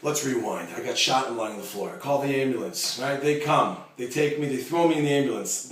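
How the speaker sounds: loud, emphatic storyteller; David telling his friend the story, preached loud to the crowd; preaching at full force. Acted. Loud sermon retelling as David.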